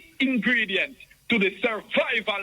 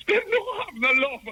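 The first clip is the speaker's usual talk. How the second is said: laughing; 13 semitones higher than usual. Real, not acted. Laughing complaint to radio host.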